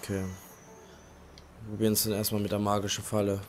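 ordinary delivery

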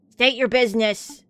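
loud, official challenge; gruff official gatekeeper. Acted. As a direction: demand it like a guard at the gate. Game guard challenges the travellers.

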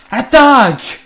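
high, very loud battle cry; charging shout. Acted. Guild members pouncing on dinner, high loud shout. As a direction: yell it like a charge.

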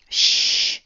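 sharp, forceful shushing; loud, voiceless shush; hushing shh. A narrator's reading. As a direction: hush a noisy friend. Boy hushes shrieking friend.

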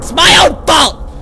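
scared horror game streamer. Real, not acted. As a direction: yell at yourself in defeat. Loses after jump scare.

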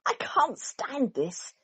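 slow, drawn-out complaint; exasperated. A narrator's reading. Mister Bear, sleepless, complaining slowly and wearily. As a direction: stretch it out, fed up. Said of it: "said Mister Bear".

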